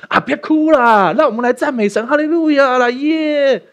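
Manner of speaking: high, loud hammed-up cheering; mock cheerleader. Pastor mocking himself cheering up mourners, hammed-up loud cheer. Mocks a cheery miracle worker.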